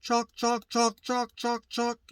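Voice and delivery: high, rhythmic "chalk" in turkey cadence; mimicked turkey yelp. Shown as a demonstration. Teacher giving an example after the call, high turkey mimicry. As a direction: chant each "chalk" as a high, even yelp. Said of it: "So here's an example".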